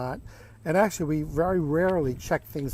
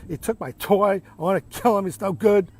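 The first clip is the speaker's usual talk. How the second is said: put-on loud, higher outburst of a little boy; mock screaming child; 5 semitones higher, 7 dB louder than usual. Little boy venting about his brother, acted angry yelling. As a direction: yell out a child's tantrum, half in earnest. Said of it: "starts screaming and yelling".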